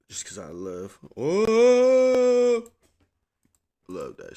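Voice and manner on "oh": high, loud squeal of delight; sudden squeal. Real, not acted. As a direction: let out a thrilled squeal. Sudden excited outburst.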